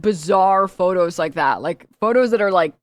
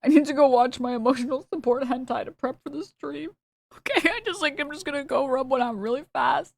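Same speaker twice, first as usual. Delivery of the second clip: higher put-on voice mocking his friend; mock impression of a buddy, pitched higher; 6 semitones higher, 4 dB quieter than usual. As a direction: lampoon the friend's excuse in a raised, put-on voice. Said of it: "Bro's like".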